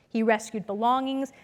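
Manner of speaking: ordinary delivery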